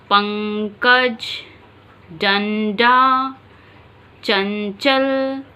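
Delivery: पंकज, डंडा, चंचल said very slowly, humming the nasal through the nose; nasal sound demo. Shown as a demonstration. Teacher shows students the anusvara, very slow nasal words. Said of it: "आप उच्चारण देखिए इसका".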